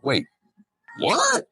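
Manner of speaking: a high, startled wait, what; surprised. Retold or imitated. Confused trucker hearing the audit advice, high startled disbelief. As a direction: jump way up in disbelief. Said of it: "And they're like".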